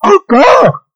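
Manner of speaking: very high, loud exasperated cry; irritated surprise. Acted. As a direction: squeal it in annoyed disbelief. Frost spirit irritated.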